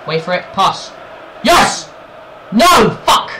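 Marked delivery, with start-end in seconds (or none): 1.4-3.3 high, loud bursts; sharp gamer outbursts; shouted reactions. Real, not acted. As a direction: swing from joy to dismay, yelling. Chance comes and goes.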